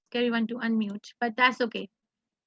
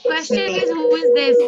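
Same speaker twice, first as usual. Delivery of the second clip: much higher and louder, eager; excited quiz question; 7 semitones higher, 6 dB louder than usual. Real, not acted. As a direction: throw the question out with a thrill. Quiz host excited over picture.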